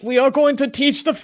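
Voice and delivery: lightly hammed-up German accent. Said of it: "in a, um, certain bad man's German accent".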